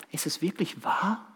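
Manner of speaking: unvoiced whisper of a nagging doubt. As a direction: hiss it like a doubt in your ear. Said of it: "die dir zuflüstern".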